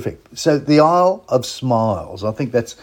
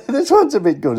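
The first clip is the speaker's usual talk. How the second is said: repeated through a real laugh, pitched up high; 9 semitones higher, 5 dB louder than usual. Real, not acted. Host cracking himself up over the song, laughing. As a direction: giggle your way through the repeat.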